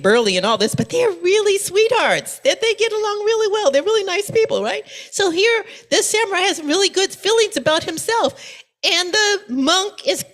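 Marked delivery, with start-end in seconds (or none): none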